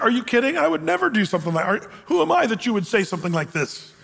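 mock outrage, rushed and high at first; indignant disbelief. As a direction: act out an incredulous denial. Acting out Hazael's indignant denial.

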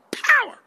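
POWER belted out high and loud; emphatic power. Shown as a demonstration. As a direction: hit "Power" way up and loud, savoring it. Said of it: "I like to say it that way".

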